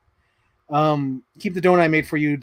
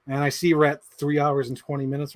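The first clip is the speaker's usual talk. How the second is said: usual speaking voice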